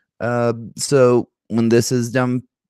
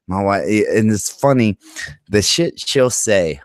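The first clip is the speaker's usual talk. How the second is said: ordinary talk, nothing marked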